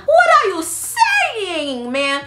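high, drawn-out exasperated cry; exasperated outcry. Retold or imitated. Niece reacting to the excuse, exasperated outcry. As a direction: stretch it high in disbelief.

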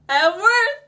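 high, loud broken cry; tearful shout. Acted. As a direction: cry it out in release. Shouting, crying.

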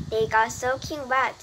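plain reading voice

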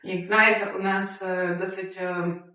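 ordinary talk, nothing marked

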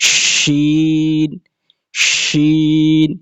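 শিন held very long, the শ hissed with a full mouth of air; drawn-out letter name. Shown as a demonstration. Quran teacher showing students the letter শিন, long and hissing. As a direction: stretch শিন slowly, letting air rush out. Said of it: "পুরো মুখ ভরে বাতাস বাইর হবে".

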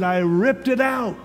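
retold outburst. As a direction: blurt it out, worked up. Pastor confessing the font-smashing.

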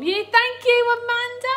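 high, excited thank-you; delighted thanks. Real, not acted. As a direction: sing out grateful thanks. Thanks viewer for donated stars.

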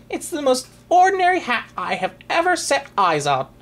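high, haughty fussy little man; pompous hatter voice. Acted. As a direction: puff yourself up, high and grand. Pompous hatter Sir Snips speaks.

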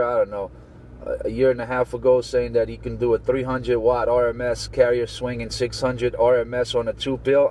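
normal talking voice

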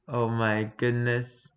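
ordinary delivery

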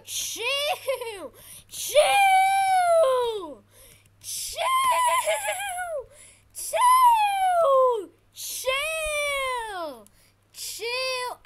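very high, drawn-out shrieks; screaming. Real, not acted. Gamer begging the boss to chill, drawn-out screaming. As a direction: shriek it long and loud at the attacker.

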